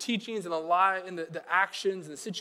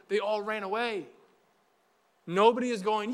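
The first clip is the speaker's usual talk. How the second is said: usual speaking voice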